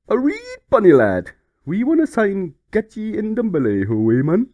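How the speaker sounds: put-on loud, slurred drunk Geordie voice. Reading the Newcastle fax as a loud drunk Geordie. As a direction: slur it like a drunk lad from Newcastle. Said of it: "He sounds pretty drunk".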